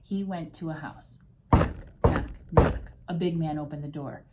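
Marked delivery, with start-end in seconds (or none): 1.5-2.9 sharp, loud spoken knocks; three separate punched knocks; knocking sound. Acted. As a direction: imitate raps on a door. Imitates knocking at a door.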